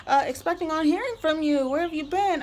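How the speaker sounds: high, bubbly fake-friendly voice; mock sugary saleswoman. Mocking the saleswoman who signed her up, high fake-cheery voice. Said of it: "she was like".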